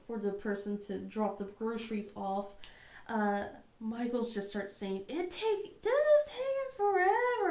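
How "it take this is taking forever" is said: put-on high, far-off child whine; whiny kid. Mom imitating son in the car, a high whine. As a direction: whine like a bored little boy.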